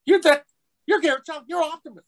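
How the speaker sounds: loud, squeaky-high starstruck outburst; imitated excited fan. Fan recognizes actor's voice on the bus, thrilled high outburst. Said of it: "He says".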